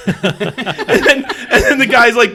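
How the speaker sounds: laughing high and loud through the words. Real, not acted. Telling friends about the cop, laughing loudly. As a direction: crack up mid-story.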